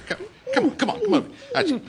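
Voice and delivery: very high, sing-song coaxing; high cooing call to a bird on the sill. Real, not acted. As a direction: coo the pigeon over to you. Coaxing a pigeon to come closer.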